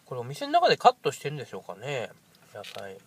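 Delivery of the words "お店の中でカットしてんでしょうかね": ordinary talk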